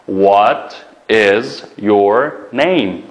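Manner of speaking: very slow, each word drawn out; slow, clear classroom model; slow teaching model. Shown as a demonstration. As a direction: spell it out slowly for the class. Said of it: "Ismingiz nima?".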